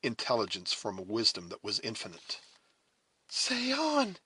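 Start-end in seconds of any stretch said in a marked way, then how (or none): 3.3-4.2 high, eager plea; urgent cry. A narrator's reading. Alice urging her sister to continue, urgent cry. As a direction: urge the speaker on, pitched high. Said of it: "cried Alice".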